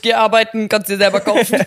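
laut und streng zum Kleinkind; strenge Mutter. Pretend. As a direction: schimpf es wie eine strenge Mutter an. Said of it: "So zu meinem Dreijährigen". (laute, strenge Mutter)

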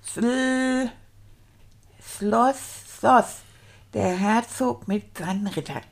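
slow, stuttered sounding-out of words; halting beginner reading. Acted. Beginner reading a dragon story aloud, halting and laboured. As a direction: read haltingly, stretching out difficult syllables.

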